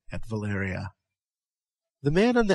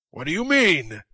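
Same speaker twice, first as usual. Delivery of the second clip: growled; 5 semitones higher, 4 dB louder than usual. A narrator's reading. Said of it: "growled Conan".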